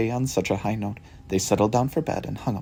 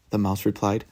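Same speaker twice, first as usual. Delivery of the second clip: ordinary delivery, nothing marked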